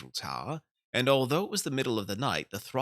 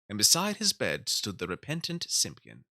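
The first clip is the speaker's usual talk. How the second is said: plain reading voice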